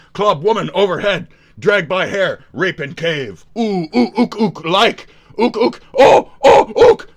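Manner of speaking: building from loud grunts to a high shrieking ape call; hammed-up caveman. Mocking Asmon as a caveman, hammed-up ape grunts.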